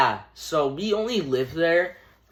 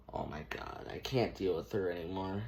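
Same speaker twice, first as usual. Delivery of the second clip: fed-up whisper; 7 semitones lower, 13 dB quieter than usual. Retold or imitated. Mom quoted, fed up.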